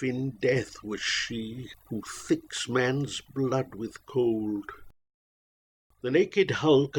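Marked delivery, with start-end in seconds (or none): none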